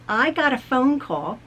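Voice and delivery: ordinary talk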